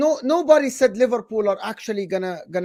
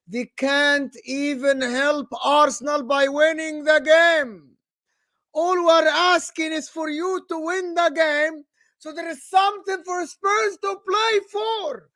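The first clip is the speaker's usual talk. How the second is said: much higher, slow, shouting fury; heated football rant; angry rant; 8 semitones higher, slower than usual. Real, not acted. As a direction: rant at the team, outraged. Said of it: "Why are you angry?".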